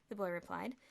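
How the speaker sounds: ordinary delivery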